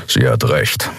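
low grumbled agreement; grumbling. Acted. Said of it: "brummte Kero".

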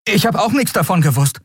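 put-on high-pitched young lad's voice; earnest boy. Boy admitting he knew nothing, high and earnest.

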